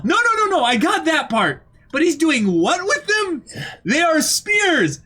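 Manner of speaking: very high, exasperated mock disbelief; hammed-up high-pitched astonishment; incredulous outburst. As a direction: act out someone losing it over absurd news. Acts out disbelieving exchange about giant.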